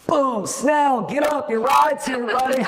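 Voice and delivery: high, loud pumped-up call; energetic shout, laughing at the end; hyped shout. Shown as a demonstration. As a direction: wake him up like a hype man. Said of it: "or should I be like".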